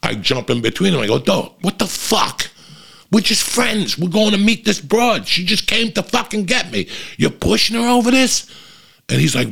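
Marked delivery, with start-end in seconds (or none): 1.2-8.5 high-pitched, worked-up pleading of a case; acted-out heated confrontation; indignant protest. Acted quarrel with angry boyfriend.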